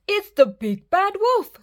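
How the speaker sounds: high, loud panicked piglet; frightened cry. Acted. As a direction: shriek the warning in fright. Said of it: "cried Horace".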